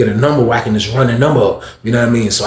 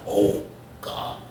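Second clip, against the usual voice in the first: hushed dread; 12 dB quieter than usual. Retold or imitated. Narrator dreads the fight.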